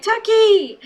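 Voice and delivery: high, loud mock shout; big gleeful holler, imitated; excited call. Otis calling out to Tucker.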